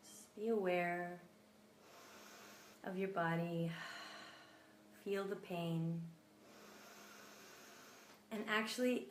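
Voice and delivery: soft, unhurried meditation voice with long pauses; guided meditation. Acted. Guide listeners to breathe into their pain, soft meditation voice. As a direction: ease listeners into their body, gently.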